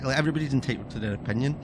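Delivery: normal talking voice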